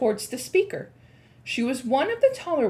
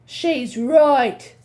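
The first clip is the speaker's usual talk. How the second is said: crying out; 4 semitones higher, 8 dB louder than usual. Acted. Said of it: "cried another voice".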